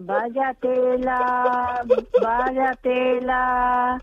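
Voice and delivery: drawn-out, very slow disbelief; slow dismay. Real, not acted. [name] reacts to learning it was a prank, slow disbelief. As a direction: stretch each word out in dismay.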